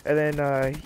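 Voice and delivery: ordinary delivery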